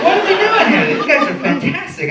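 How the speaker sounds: high, loud, marvelling squeal; amazed falsetto. Retold or imitated. Comic telling his own awe at the old porter. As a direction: squeal it out in disbelief. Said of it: "I'm like".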